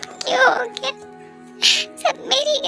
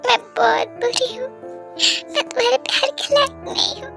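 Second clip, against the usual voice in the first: higher-pitched, grief-stricken lament; anguished self-blame; 4 semitones higher than usual. Acted. Guilty over the one who loved her, anguished. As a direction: break down in guilt.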